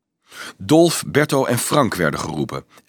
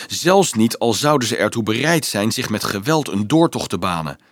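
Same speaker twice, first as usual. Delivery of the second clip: ordinary delivery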